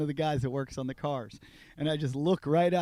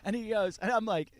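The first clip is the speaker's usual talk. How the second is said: plain reading voice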